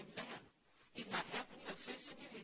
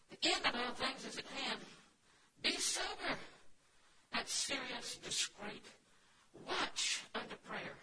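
loud, much higher proclaiming, slow on the last words; preacher proclaiming First Peter to the church; forceful scripture reading; 4 semitones higher, 8 dB louder, slower than usual. Acted. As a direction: declaim the verse like a pulpit reading.

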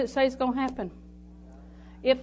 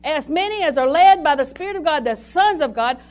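higher, much louder preaching at full force; 5 semitones higher, 8 dB louder than usual. Acted.

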